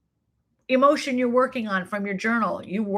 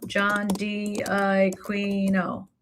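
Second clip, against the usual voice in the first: very slow, stretched-out syllables; John D'Aquino at dictation pace; drawn-out while typing; much slower than usual. Real, not acted. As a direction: sound the name out slowly as you type it. Sounding out name while typing.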